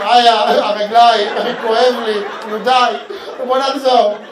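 loud, slow mock moan of a worn-out husband; whining man. Comedian imitating husbands tired in the mall, loud whine. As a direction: groan like a man dragged shopping.

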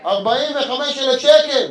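loud, high, drawn-out indignation; comic outrage. Acted. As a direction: belt out the price slowly, scandalized. Outraged at entertainer's fee.

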